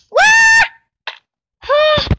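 loud high shriek of fright; panicked scream. Real, not acted. Zombie attack screaming.

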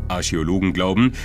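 ordinary talk

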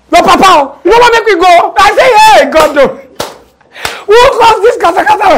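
panicked plea. Retold or imitated. Panicked plea during wild ride.

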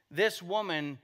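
ordinary talk, nothing marked

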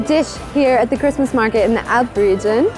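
normal talking voice

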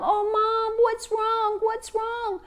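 little ones crowding round their mom; anxious children. Acted.

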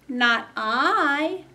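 high, loud kitty meow; meowing cat voice. Acted. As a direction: mew it like a cat. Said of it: "meowed the cat".

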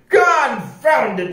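slow, high, exasperated shout; shouting pirate. A narrator's reading. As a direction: shout the oath as if stamping a foot. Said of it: "shouted Pete".

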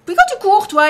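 crie plus fort et plus aigu; apostrophe indignée, voix forte. Real, not acted. Crie contre un zombie qui court. (cri indigné)

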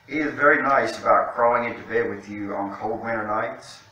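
ordinary delivery

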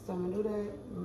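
ordinary talk

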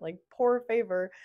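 mock American Spanish accent, "poor favor" with a long English "oo" and an American r; bad American Spanish accent. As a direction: lightly mimic an American voice mangling "poor favor". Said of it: "it has a terrible Spanish accent".

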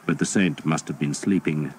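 plain reading voice

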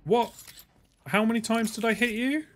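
high, loud incredulous shout; outraged disbelief. Real, not acted. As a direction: yell in disbelief at the screen. Enemy won't die.

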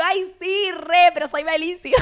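high, giddy imitation of herself; self-mocking gush. Drunk fan answering the drummer, high mock gush. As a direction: gush back, oblivious and eager.

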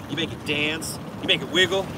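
ordinary delivery

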